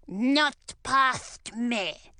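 very slow, drawn-out troll voice. Acted. Shaggy troll denying blame, very slow troll voice. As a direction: drag out each word like a troll.

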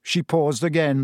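usual speaking voice

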